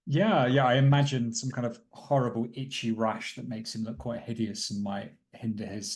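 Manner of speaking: ordinary talk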